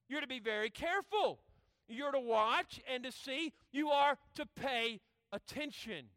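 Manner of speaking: loud, emphatic preacher hammering the warning; preaching at full force. Acted. Preacher warning the congregation about a mountain path, full-force preaching. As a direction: preach it loud, leaning hard on key words.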